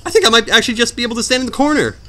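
high-pitched, incredulous discovery; excited realisation. Real, not acted. Player realising he can just stand in the corner, excited realisation. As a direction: jump up high as the idea dawns. Sudden realisation of easy strategy.

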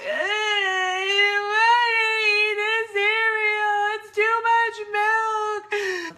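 put-on high, loud, drawn-out kid whine; whining child. Four-year-old complaining to mom about breakfast cereal, loud whining. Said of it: "like, whining all day".

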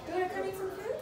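very high, singsong hostess; put-on hostess voice. Mom as hostess inviting her child to eat, high-pitched. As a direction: usher guests along in a high-pitched server voice.

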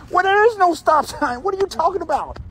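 gasp, then pitch jumps up in disbelief; what are you even talking about, high and sharp; incredulous gasp. Real, not acted. Driver to neighbor, denying the stop sign, incredulous.

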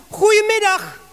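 high, loud hail at the door; called-out greeting. Acted. A voice from inside greets Zacchaeus at the door, called out. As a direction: call it out brightly to a caller.

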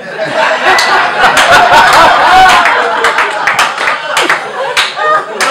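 loud helpless laughing fit; roaring laughter. Real, not acted. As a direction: burst out laughing at the pudding line. Laughs at pudding story.

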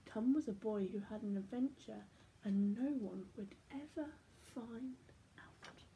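trailing away to a hush; soft bedtime fade-out; fading. Acted. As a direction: let it fade into silence. Fading to end of story.

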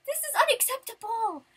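high-pitched exasperated rant; loud, indignant complaint at a shoddy prize; mad. Retold or imitated. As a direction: reenact outraged disbelief, voice shooting up. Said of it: "I was so mad".

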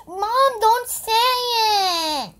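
high, loud, slow tearful wail; crying doll voice. Pretend. Doll begs mom, crying.

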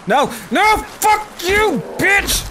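high, frantic cursing shout; panicked yell. Real, not acted. Player jumped while searching a body, panicked high yell. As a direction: shout it down in alarm. Attacked again in game.

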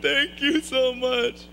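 high, loud mock sobbing; crying. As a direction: bawl it out slowly in an exaggerated tearful squeal. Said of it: "I'm crying in the bathroom".

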